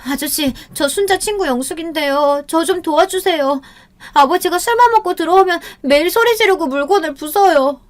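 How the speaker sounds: put-on high, pleading, tearful girl's voice; a schoolgirl clinging to her friend's father, crying; tearful plea. A narrator's reading. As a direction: beg for help while crying. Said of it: "울면서 아버지 팔에 매달렸대요".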